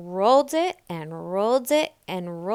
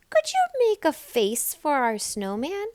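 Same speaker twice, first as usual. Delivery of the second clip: higher polite small voice; little Nell; 7 semitones higher than usual. A narrator's reading. Little Nell asks the birds for a face. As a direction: ask sweetly.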